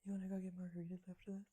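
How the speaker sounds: fast, low whisper; hushed aside. Acted. Teacher proposing drinks to colleague, hushed aside. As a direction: murmur it quickly under your breath.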